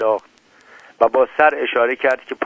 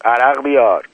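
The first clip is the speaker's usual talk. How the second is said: loud, commanding order; shouted order; 2 semitones higher, 6 dB louder than usual. A narrator's reading. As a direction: call the order out loudly. (دستور با صدای بلند)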